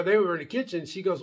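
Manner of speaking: ordinary talk, nothing marked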